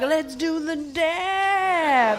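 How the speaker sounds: high, drawn-out whoop on DAB; mock-seductive enthusiasm, very slow; eager agreement. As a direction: stretch "dab" way out, high and game. Eagerly agrees, thinking it's sexual.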